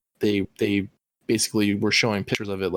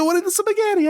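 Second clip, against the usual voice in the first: mock Italian plumber voice; 4 dB louder than usual. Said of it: "Mario and Luigi".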